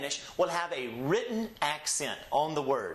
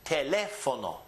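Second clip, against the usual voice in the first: Teléfono with LÉ raised, fo-no dropping after; stress on LÉ; 3 semitones lower than usual. Shown as a demonstration. Teacher modelling a word for students, raised stressed LÉ. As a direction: punch up the LÉ in Teléfono, then fall away. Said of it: "so we must raise our voice here".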